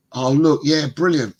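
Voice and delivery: ordinary delivery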